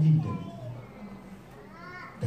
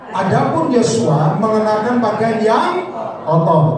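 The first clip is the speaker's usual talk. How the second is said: slow, loud, higher-pitched reading; loud scripture reading; 6 semitones higher, 9 dB louder, much slower than usual. Shown as a demonstration. Preacher leading congregation in reading, loud and slow. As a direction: read it out forcefully, drawing out each word. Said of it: "baca yang keras".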